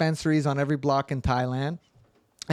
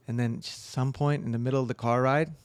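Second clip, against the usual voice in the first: normal talking voice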